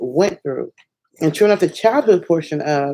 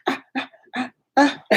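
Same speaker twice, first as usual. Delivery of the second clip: giddy squeals; 11 semitones higher than usual. Real, not acted. Giddy squeals after milestone.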